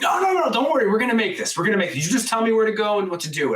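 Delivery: high, rushed brushing-off of worries; frantic insistence. Retold or imitated. Retold frantic argument while driving.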